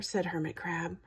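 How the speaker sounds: plain reading voice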